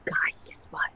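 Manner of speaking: hushed, secretive whisper; worried whisper. Real, not acted. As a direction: whisper as if someone could hear you. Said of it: "Why are we whispering?".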